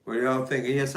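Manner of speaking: usual speaking voice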